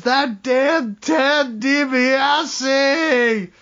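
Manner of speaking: high, drawn-out yell of frustration, hammed up; mock scream. Recapping Grimes raging at his rival, mock scream. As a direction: wail the name out slowly like a thwarted wrestler. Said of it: "as Grimes screams".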